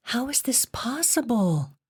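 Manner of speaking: breathless unvoiced whisper of disbelief. A narrator's reading. Jen whispers to the boy, stunned, whispering. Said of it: "she whispered".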